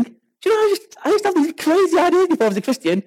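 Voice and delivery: ordinary talk, nothing marked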